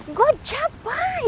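high, bright praise call; cheering. Real, not acted. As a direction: cheer her on as she arrives. Cheering daughter arriving.

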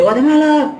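high-pitched yell of a name; retold shout. Calling his friend Guatemala to the fight, shouting. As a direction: call out to someone far across the block. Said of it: "le grité".

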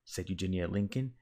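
usual speaking voice

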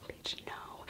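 barely audible whisper; secretive, breath-only retold warning; mouthed warning. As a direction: mouth it at your friend with almost no voice. Silent warning look while walking to intake.

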